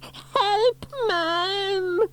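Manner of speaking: very high crying plea; frightened wail. A narrator's reading. Boy at burning window, high frightened wail. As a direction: wail for rescue. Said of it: "wailed Norman".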